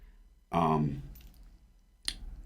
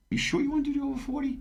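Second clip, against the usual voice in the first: imitating the wife; much faster than usual. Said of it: "She's like".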